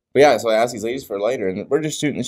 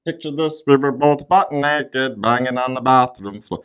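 slow, raised impersonation voice; 5 semitones higher than usual. Acted. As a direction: attempt an impression, pitched up and drawn out. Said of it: "now I sound like [name]".

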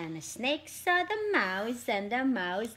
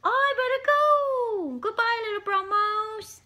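much higher, alarmed, rushing off; panicked flight; 10 semitones higher, 4 dB louder than usual. Acted. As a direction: gasp then squeal in a panic. Snake panics and flees.

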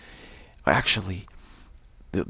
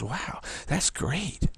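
mock delighted Jesus; 7 semitones higher, much slower than usual.

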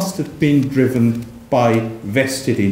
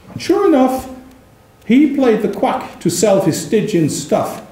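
poem reading; 8 semitones higher than usual. Acted. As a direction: roll out the insult like a pamphleteer. Reads poem couplets.

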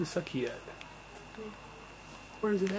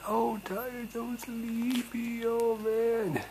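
sleepy coo; 9 semitones higher, 5 dB quieter, much slower than usual. Acted. Cooing over the sleepy baby.

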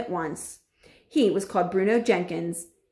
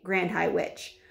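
ordinary delivery

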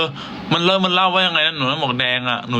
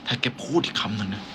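much softer, low menace; quiet villain threat; 4 semitones lower, 8 dB quieter than usual. Acted. Villain warning the hero, soft and menacing. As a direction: threaten under your breath.

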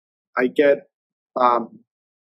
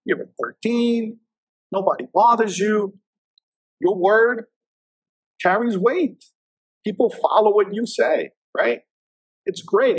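high, slow, emphatic voice of the boss; boss's advice; 7 semitones higher, slower than usual. Retold or imitated. Boss explaining the perks of the rank, higher and emphatic. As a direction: lift the voice and lean on each point.

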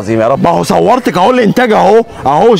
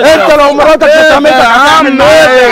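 angry shout; 6 semitones higher, 5 dB louder than usual. Acted. Husband shouts at bystander.

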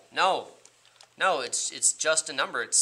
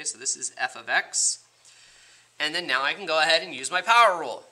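ordinary talk, nothing marked

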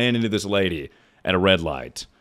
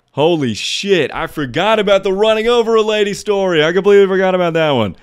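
louder and much higher, rushing as it comes back; thrilled to suddenly remember a wild story; real excitement over a forgotten story; excited; 6 semitones higher, 5 dB louder than usual. Real, not acted.

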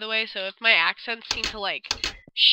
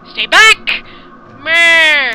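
much louder, higher cry; panicked scream; 5 semitones higher, 15 dB louder, much slower than usual. Acted. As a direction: scream to keep her back. Ren fending off attack.

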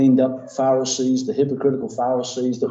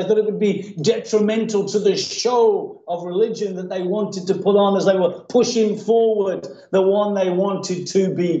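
raised, insistent sermon delivery; impassioned preaching; 9 semitones higher than usual. Real, not acted. Preacher exposing the crowd who shun beggars, impassioned. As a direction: preach it hard, voicing the crowd's indignation.

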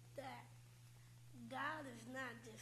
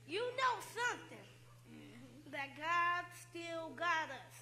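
preaching; 9 dB louder than usual. Real, not acted. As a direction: shout the good news to the church. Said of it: "He's a preacher right there".

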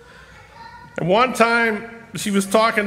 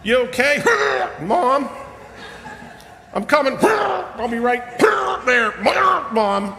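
raised, higher voice calling through a door; 6 semitones higher than usual. Retold or imitated. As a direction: call out to mom from the next room. Calling through door to ill mother.

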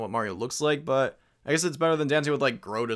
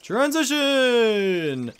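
announcer call; 9 semitones higher, 4 dB louder, much slower than usual. Pretend. Player announces scene change.

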